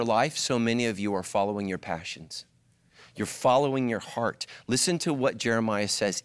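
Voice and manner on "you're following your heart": ordinary talk, nothing marked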